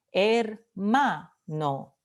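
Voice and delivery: "hermano" slowly split into her, MA, no; syllable by syllable. Shown as a demonstration. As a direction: sound out "hermano" slowly, stressing the middle MA. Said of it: "penúltima sílaba".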